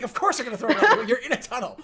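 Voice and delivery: high, loud retort through laughter; mock exasperation. Game master voicing her retort about the tunnel, mock exasperation. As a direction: protest the obvious, laughing.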